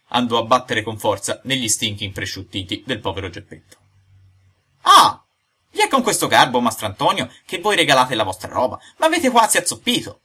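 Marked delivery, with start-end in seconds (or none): 4.8-5.2 loud, very high yelp; sudden acted shriek of pain; cry of pain. Struck hard on the shins. 5.7-10.2 loud, higher-pitched scolding after a knock; aggrieved, loud protest; indignant complaint. Acted. Hurt man scolds his friend.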